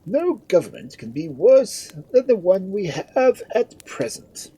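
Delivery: high, loud, self-important lordly voice; puffed-up peer holding forth; pompous lord. A narrator's reading. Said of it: "said Lord Gustavus".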